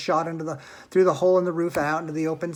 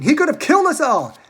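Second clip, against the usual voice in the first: scream; 11 semitones higher, 10 dB louder than usual. A narrator's reading. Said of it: "screamed Grandma Josephine".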